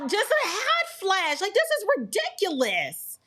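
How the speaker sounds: high-pitched exasperated venting; vent, fed up with the hot flash. Real, not acted. Venting about sudden hot flash.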